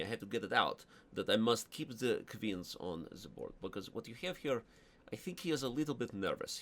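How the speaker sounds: put-on Russian-accented English with Russian chess words. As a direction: play a Russian grandmaster thinking aloud. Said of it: "These are the accents I'm not allowed to do".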